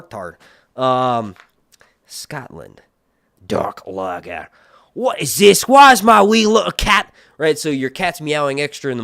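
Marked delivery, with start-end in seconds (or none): 2.0-7.1 mock Scottish accent. Streamer puts on a Scottish accent to mock a viewer. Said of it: "let me engage my Scottish accent".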